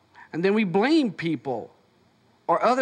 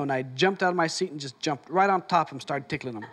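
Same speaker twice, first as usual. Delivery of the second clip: normal talking voice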